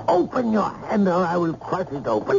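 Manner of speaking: rising harsh command; threatening. Acted. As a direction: order it with menace. Uncle threatens to crush hand.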